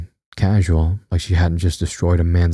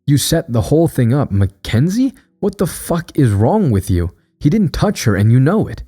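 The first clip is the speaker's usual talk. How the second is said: furious accusation; 7 semitones higher than usual. Acted. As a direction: throw the accusation at her, voice climbing. Sister confronts Annie in rage.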